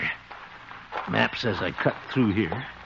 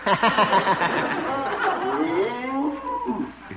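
nervous laugh; 13 semitones higher than usual. Acted.

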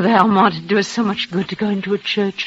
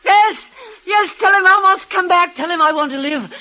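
desperate pleading; 9 semitones higher than usual. Acted. Dying woman begs for doctor.

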